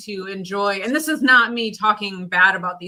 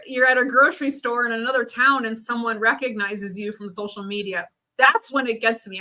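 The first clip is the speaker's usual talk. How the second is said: ordinary delivery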